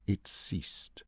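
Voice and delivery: a breathless whisper. A narrator's reading. Narrator telling the listener the heart stopped, whispered. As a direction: let it die to a whisper.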